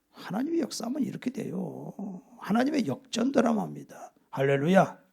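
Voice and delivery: usual speaking voice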